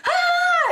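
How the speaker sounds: very high laughing mock shriek of a woman in labor; hammed-up labor scream, cracking into laughter. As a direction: shriek it way up, giggling through it. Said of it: "you guys were probably both screaming".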